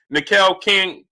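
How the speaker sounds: normal talking voice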